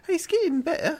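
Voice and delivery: high mock British voice; hammed-up Python impression; Monty Python quote. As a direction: quote the sketch in a silly English voice. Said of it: "is that from the, uh, Monty Python?".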